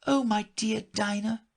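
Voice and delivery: put-on high girl's voice, forlorn; melancholy. A narrator's reading. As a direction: lament to yourself, missing your pet. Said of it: "in a melancholy tone".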